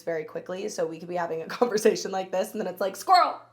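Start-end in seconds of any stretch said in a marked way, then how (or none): 2.9-3.4 sudden high loud blurt; sudden excited. Retold or imitated. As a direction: burst out like you spotted something. Mimicking distraction.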